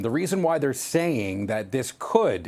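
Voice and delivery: slow down and lean hard on "could"; stress on COULD. Real, not acted. Reporter cautioning viewers about a tough season, stress on "could". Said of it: "emphasize the word could".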